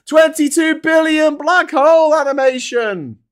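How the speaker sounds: high, loud mock announcer shout. Host hyping a running joke animation, shouting. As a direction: belt it out like a jingle intro.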